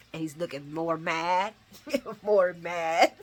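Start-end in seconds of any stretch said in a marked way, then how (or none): none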